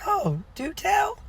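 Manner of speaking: high, drawn-out "do tell"; coaxing, slow and high-pitched; intrigued mock interest. Pastor acts intrigued by guide's remark.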